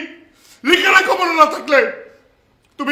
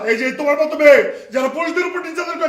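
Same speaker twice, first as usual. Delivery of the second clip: usual speaking voice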